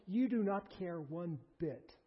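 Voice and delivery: usual speaking voice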